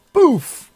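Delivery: high, loud "boof"; imitated yank noise. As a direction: punch out a comic impact noise. Imitates the yank over bar.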